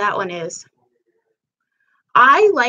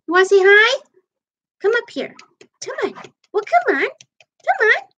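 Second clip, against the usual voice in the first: high-pitched coaxing, as to a pet; pet-talk voice; 11 semitones higher than usual. Real, not acted. Owner calls Lily up to say hi, high pet-talk voice. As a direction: coax it up in little high, cooing calls.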